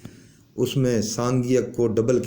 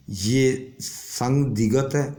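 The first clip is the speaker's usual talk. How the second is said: normal talking voice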